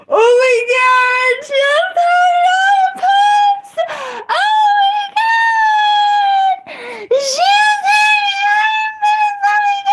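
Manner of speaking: very high, loud hysterical shrieking; shrieking chat. Retold or imitated. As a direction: screech like a horrified crowd. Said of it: "Sorry for yelling that loud".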